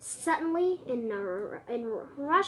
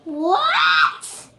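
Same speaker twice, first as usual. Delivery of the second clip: high, loud startled shout; shocked shout; 6 semitones higher, 13 dB louder than usual. Acted. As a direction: blurt it out in shock. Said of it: "piped up a familiar voice".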